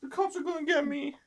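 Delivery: high-pitched fretful voice; squeaky pretend fear; mock panicked fugitive. Co-host mimics fleeing man.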